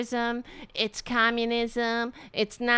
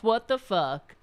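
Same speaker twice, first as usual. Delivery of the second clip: normal talking voice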